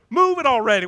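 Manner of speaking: loud impatient yell at the workers. Retold or imitated. Impatient driver yelling at pothole workers, loud. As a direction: shout them out of the way. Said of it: "and we yell at them".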